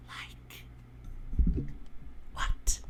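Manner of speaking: tiny, hushed whisper; barely audible. A narrator's reading. Woman answering the man's plea, barely audible whisper. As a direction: whisper it so it hardly carries. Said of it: "Her voice is barely audible".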